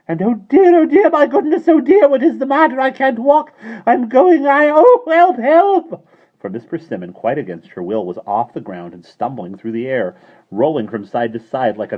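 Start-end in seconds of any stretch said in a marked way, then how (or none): 0.1-6.1 high, loud rising panic; panicked cries. A narrator's reading. Landlady lifting off, crying for help. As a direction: flail as you float up. She floats against her will.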